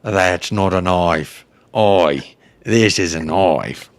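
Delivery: slow, mock Australian accent. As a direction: lay on a hammed-up Australian accent, slowly. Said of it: "do an Australian accent".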